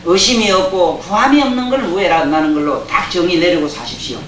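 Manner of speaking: ordinary delivery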